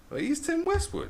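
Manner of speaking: high, loud disbelieving exclamation; jaw-drop surprise; shocked realisation. Retold or imitated. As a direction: blurt it out in astonishment. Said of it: "I was like".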